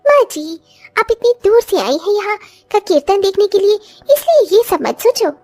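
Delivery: put-on high voice of a young daughter-in-law offering help. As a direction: lift the voice into an eager young bride.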